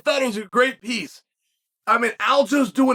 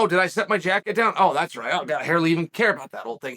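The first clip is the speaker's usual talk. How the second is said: lower, quick, breezy fake indifference; lower-pitched, fast mock casual act; mock nonchalant shrug-off; 7 semitones lower, faster than usual. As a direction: toss it off like you couldn't care less about the jacket. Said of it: "he could have acted as though".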